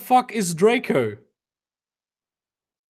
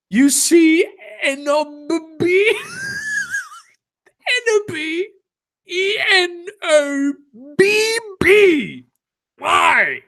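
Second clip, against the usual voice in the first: much higher, squealing through laughter; laughing fit; 9 semitones higher, slower than usual. Real, not acted. Reader spelling a misspelled name, breaking into squealing laughter. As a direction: lose it while spelling the name out.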